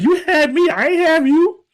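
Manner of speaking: high sassy comeback; mock mother clapping back. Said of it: "It's like".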